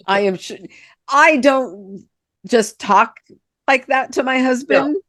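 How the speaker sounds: ordinary talk, nothing marked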